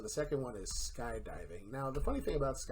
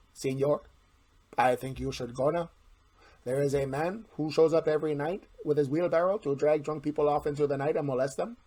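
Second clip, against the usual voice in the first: a shaky, hammed-up Mexican accent, a bit louder; bad Mexican accent; 3 semitones higher, 7 dB louder than usual. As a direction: put on a cheesy Mexican accent. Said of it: "I apologize for that accent".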